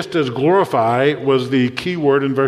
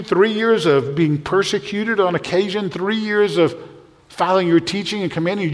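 higher, pleading disbelief, slowing on the key words; exasperated protest; 6 semitones higher than usual. Acted. Disciples pleading with Jesus not to leave, higher and incredulous. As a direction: make the hurt plain, really?.

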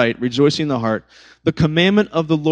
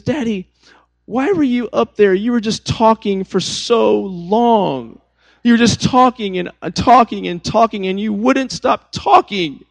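put-on high whiny kid complaint, stretching words out; whiny little girl; 6 semitones higher than usual. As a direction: imitate a small daughter whining at Daddy. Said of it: "like, whiny voice".

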